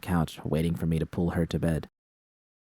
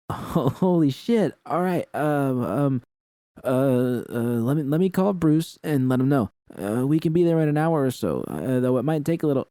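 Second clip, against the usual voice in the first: Roxanne voiced higher, stammering with excitement; higher, tripping over words in excitement; an excited, flustered Roxanne voice, pitched higher; 7 semitones higher than usual.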